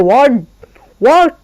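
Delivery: what in an American accent, rising, glottal t. Shown as a demonstration. Teacher imitating American speakers saying what. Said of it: "American what produce करते हैं".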